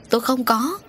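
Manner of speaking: an understated scream of denial; mild scream. A narrator's reading. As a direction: cry out in a restrained yell. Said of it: "Bà Dương gào thét".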